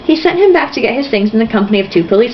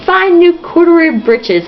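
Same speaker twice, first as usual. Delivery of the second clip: disgusted emphasis; 7 semitones higher than usual. Real, not acted. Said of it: "I hate the word breeches".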